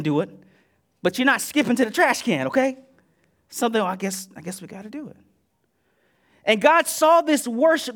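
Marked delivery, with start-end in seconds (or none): 3.8-5.2 quick, softer, reluctant mumble; grudging mumble. Retold or imitated. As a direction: toss it off like a bored duty. Grudging churchgoer quoted.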